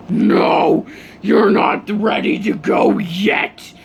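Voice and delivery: harsh, half-voiceless demonic rasp; demon mode. Acted. Enraged hero tormenting the dying villain, demonic menace. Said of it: "He goes full on demon mode".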